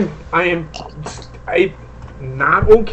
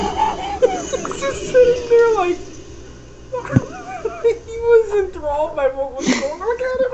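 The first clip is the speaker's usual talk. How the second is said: can barely get it out for laughing; 14 semitones higher than usual. Real, not acted. Describing while still laughing.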